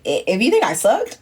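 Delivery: ordinary delivery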